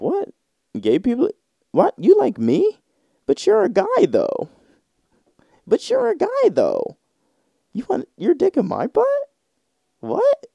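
squealing high in mock disbelief; very high hammed-up shock; shrill shocked dude. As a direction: shoot way up, flabbergasted.

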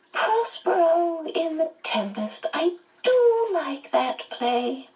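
high, leisurely, savoring voice; smiling Missus Who. A narrator's reading. Missus Who naming her favorite play, high and smiling. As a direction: linger high on the words with pleasure. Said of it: "she smiled broadly".

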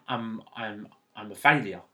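low, hushed admission, retold; downcast confession. Grandson confessing to his granddad, quietly downcast. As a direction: say it small and halting, as if remembered. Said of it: "And I cried".

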